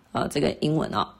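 ordinary talk, nothing marked

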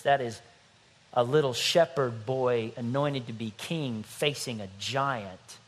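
ordinary talk, nothing marked

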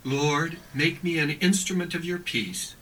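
ordinary talk, nothing marked

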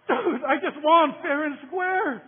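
high, loud, slow, outraged objection; indignant protest. Retold or imitated. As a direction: dig in and insist you won. Kid protesting to bully after winning.

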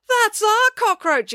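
put-on very high, drawn-out girlish excitement; excited exclamation. A narrator's reading. April sees her cockroach leading, high excited exclamation. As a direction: squeal it with pride, slowly. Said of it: "exclaimed April".

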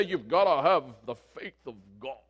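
low, mocking preacher voice; a hint of a pompous preacher. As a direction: imitate a stiff preacher. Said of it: "Preachers want to stand up and say".

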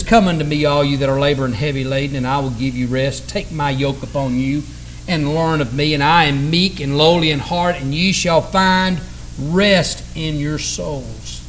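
low, even reciting voice; scripture recitation. Acted. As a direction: recite the verse gently, slowing on the last words.

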